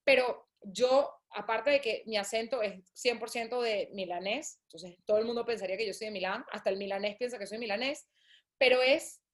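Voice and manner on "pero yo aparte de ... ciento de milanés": ordinary talk, nothing marked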